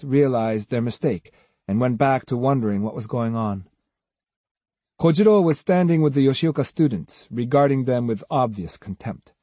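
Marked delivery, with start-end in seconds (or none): none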